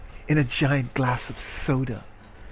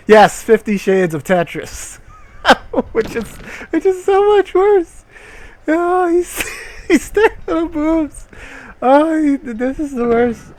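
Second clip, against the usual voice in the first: much higher, cracking with laughter; laughing through words; 13 semitones higher, 5 dB louder than usual. Real, not acted. Streamer mocking the book's line, high and laughing. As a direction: giggle helplessly at the awful book.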